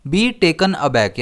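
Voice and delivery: Be taken aback spoken very slowly and clearly; slow teacher model of a phrase. Shown as a demonstration. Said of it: "वो idiomatic expression क्या है".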